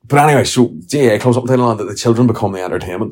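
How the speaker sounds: ordinary talk, nothing marked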